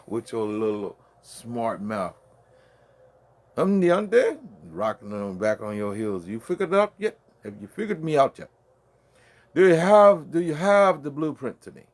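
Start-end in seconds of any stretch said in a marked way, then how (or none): 3.6-11.8 loud, higher-pitched trash talk at a rival; loud taunt. Real, not acted. Taunting a rival fighter who hasn't studied his tapes, loud and higher. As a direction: bark challenges at him, rising up.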